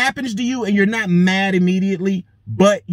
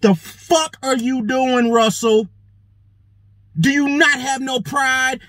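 higher, drawn-out reproach; indignant scolding; 5 semitones higher, slower than usual. Acted. Relative checking Russell over his pride, indignant. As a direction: stretch the question in mock outrage.

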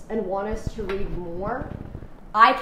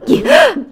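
loud, high gasp; sharp intake of breath; 12 semitones higher, 12 dB louder than usual. Acted. Reader acting out the man's rush of air, gasp. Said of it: "sucks in a rush of air".

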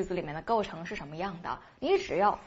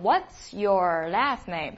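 slow model question; much slower than usual. Shown as a demonstration. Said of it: "应该问成".

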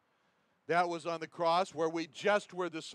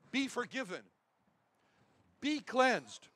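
commanding voice; 6 semitones higher than usual. Pretend. Preacher voices the Lord pointing to a sinner, commanding and raised. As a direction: pronounce pardon with full authority. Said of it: "the commanding voice".

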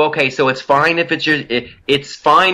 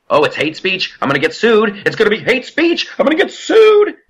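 rising to a squeaky panicked mock whine, much higher at the end; mocking panicked critics; 8 semitones higher than usual. Host mocking critics who fear the show, panicky high mock voice. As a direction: mimic the scared critics, climbing into a shrill squeal.

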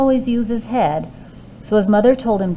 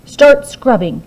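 louder bossy order; giantess ordering; 3 semitones higher, 8 dB louder than usual. A narrator's reading. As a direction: command him to work. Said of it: "she ordered".

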